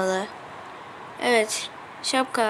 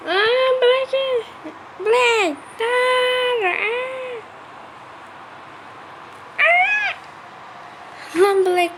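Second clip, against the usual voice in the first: panicked calling; 11 semitones higher, much slower than usual. Real, not acted. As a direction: call out to stop him. Arkadaşına panikle sesleniyor. (panikle seslenerek)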